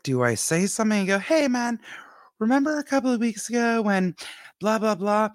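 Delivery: high fast mock casual hey man; awkward rehearsal. To her co-host, rehearsing what to say to the neighbor, high and fast. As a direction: rush through a nervous rehearsed speech, pitched high. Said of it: "I'm like".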